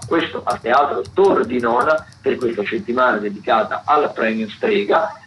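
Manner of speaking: ordinary talk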